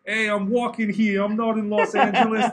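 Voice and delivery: hammed-up New Yorker, loud and high; New York accent. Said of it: "That's my New York accent".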